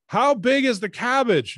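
high, slow, loud interviewer's question; high slow question into a bad phone line; interviewer asking slowly. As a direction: ask it slowly and loudly, as if to someone hard of hearing. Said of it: "Barbara was like".

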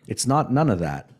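usual speaking voice

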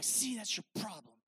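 low, softened scolding voice in the head; mean inner voice. Acted. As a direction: belittle with a low, understated insult. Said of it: "I have a mean voice in my head".

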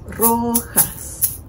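Rojas in two beats, Ro-jas; syllable by syllable. Shown as a demonstration. Teacher confirming two syllables, Rojas split in two. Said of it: "Dos sílabas".

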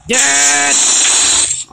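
high loud declaration; triumphant shout. Real, not acted. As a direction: call it out like a verdict. Proclaiming a kill in game.

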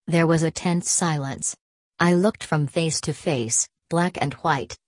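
usual speaking voice

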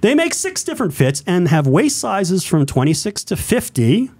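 upbeat radio ad read. Shown as a demonstration. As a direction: read it like a ballgame radio announcer. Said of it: "I do my radio voice".

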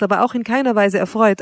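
plain reading voice

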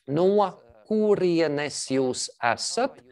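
no kurienes jūs esat drawn out very slowly, stress on no; slow Latvian question. Shown as a demonstration. Teacher modelling a question very slowly for the class. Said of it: "let me read it out".

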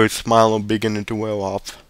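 plain reading voice

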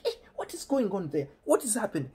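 high mock-mother questioning; imitating puzzled mother. Mother asking what is happening at home, high-voiced imitation. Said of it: "the mother is like".

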